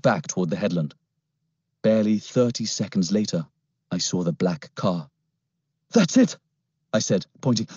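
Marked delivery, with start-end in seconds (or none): 5.9-6.4 put-on high, alarmed boy's cry; urgent boy. A narrator's reading. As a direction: point it out fast. Boy spots car.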